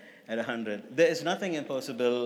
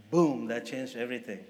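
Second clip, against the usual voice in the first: ordinary talk, nothing marked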